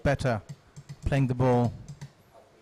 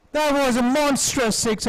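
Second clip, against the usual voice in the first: excited commentary; 10 semitones higher, 5 dB louder than usual. Real, not acted. Celebrating a huge six.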